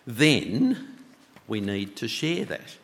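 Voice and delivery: usual speaking voice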